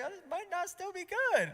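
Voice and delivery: very high, loud squeak; mock dismay. Parent joking about the newborn's looks, squeaking in mock dismay. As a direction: push it up into a comic squeal.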